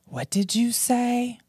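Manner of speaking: high, slow ghost voice; ghostly whisper. Shown as a demonstration. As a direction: draw it out like a faint ghost. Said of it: "Is it C".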